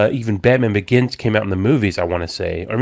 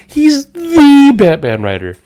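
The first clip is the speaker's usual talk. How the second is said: much higher, louder, slower outburst; excited correction; 7 dB louder, slower than usual. Retold or imitated. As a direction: insist in disbelief, voice jumping up. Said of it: "And I was like".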